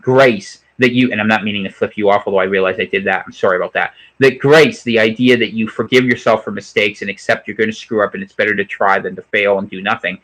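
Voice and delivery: ordinary talk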